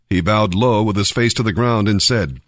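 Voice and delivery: usual speaking voice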